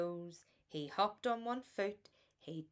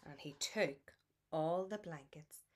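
plain reading voice

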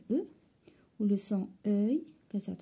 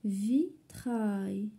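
Vitrail slowly split into two beats; syllable by syllable. Shown as a demonstration. Teacher locating the repeated sound, syllable by syllable. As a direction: stretch both syllables of Vitrail apart. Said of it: "في la deuxième syllabe".

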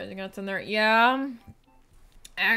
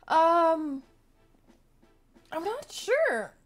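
strained and drawn out while stretching; words squeezed out mid-stretch; stretching groan; 6 semitones higher, 6 dB louder, much slower than usual. Real, not acted. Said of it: "I'm stretching right now".

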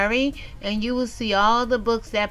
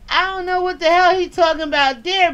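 exasperated outburst; 5 semitones higher, 4 dB louder than usual. Real, not acted. Baffled outburst over missing comment.

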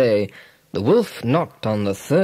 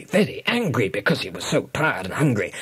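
usual speaking voice

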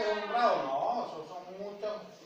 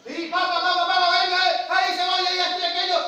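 loud, high-pitched hawking; imitated vendor shouting; 8 semitones higher, 8 dB louder than usual. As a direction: call out to pull in a market crowd. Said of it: "y gritaba".

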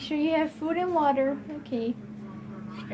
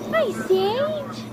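much higher sweet pet-talk voice; 12 semitones higher than usual. Real, not acted. Greeting the dog.

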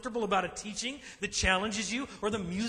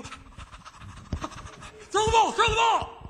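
high, loud exasperated yell at the dog; frustrated owner; 12 semitones higher, 6 dB louder, much slower than usual. Retold or imitated. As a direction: holler across the field. Owner yelling at runaway dog.

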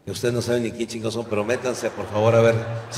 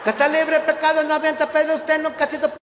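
imagined high Jesus; 13 semitones higher than usual. Pretend. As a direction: play a tiny, squeaky Jesus. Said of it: "Yo me imagino".

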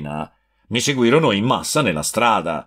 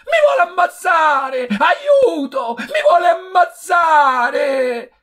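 much higher, shrieking slow cries for help; panicked screaming; 3 dB louder, slower than usual. A narrator's reading. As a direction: yell for help as if in danger. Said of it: "Bettolino urlava". (grida di aiuto in preda al panico, molto acute)

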